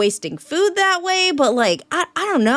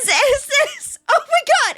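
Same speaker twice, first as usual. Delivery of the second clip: usual speaking voice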